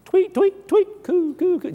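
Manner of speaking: bird sounds. Retold or imitated. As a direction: chirp like a bird, then coo like a dove. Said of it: "she starts making bird sounds".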